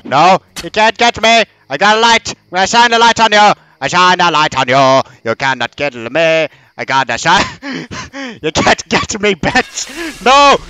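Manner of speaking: high giddy taunting; mock the monster, voice up; taunting squeal. Real, not acted.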